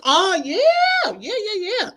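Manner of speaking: high laughing yeahs; knowing laugh at the ugly times. Real, not acted.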